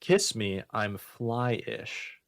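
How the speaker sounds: usual speaking voice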